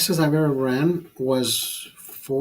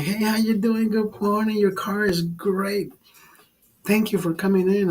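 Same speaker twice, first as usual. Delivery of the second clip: overly enthusiastic; 5 semitones higher than usual. Retold or imitated. Car-wash worker greeting customers while hyped on pills, over-enthusiastic. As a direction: pump up the cheer like a hyped car-wash guy. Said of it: "why are you being so fucking enthusiastic".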